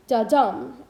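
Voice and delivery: Da-dum, light Da then strong dum; stress on dum. Shown as a demonstration. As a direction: punch the second beat in Da-dum. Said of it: "unstressed syllable followed by a stressed syllable".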